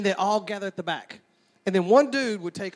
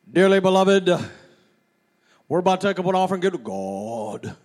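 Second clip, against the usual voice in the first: big booming pastor; 5 dB louder than usual. Retold or imitated. Childhood pastor calling for the offering, big booming voice. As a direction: open booming loud, sink deep on the last word. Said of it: "he had this big, deep, booming voice".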